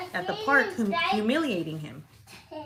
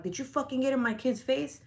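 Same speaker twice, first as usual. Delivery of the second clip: retold yelling; 6 semitones higher than usual. Mother confronting a lady over her kid, retold yell. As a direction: confront her in a raised pitch, volume held back. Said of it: "I start yelling at her".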